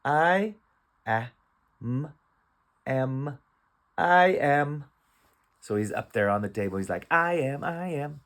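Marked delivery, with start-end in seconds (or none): 0.1-4.8 "I", then "am" split and blended, then "I am"; slow, raised sounding-out of "I am"; phonics sounding out. Shown as a demonstration. Sounding out the cat's words again. 7.1-8.2 higher singsong "I am, I am"; playful, taunting cat. Acted. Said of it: "He's taunting the other cat".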